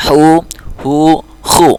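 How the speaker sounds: "Hu, hu, khu": sharp, rounded, then throaty; three contrasting h letters. Shown as a demonstration. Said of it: "di sini agak tajam".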